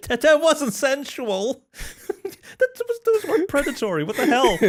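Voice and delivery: high-pitched, incredulous laughter; loud, helplessly amused objection; laughing protest. Real, not acted. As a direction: squeal it out while cracking up. Cracking up at co-host's sultry greeting.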